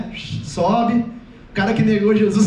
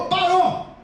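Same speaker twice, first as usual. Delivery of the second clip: raised-pitch fatherly order; a dad calling a halt; commanding father; 4 semitones higher, much slower than usual. Acted. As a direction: cut the children off firmly. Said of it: "ele até deixa a voz maior".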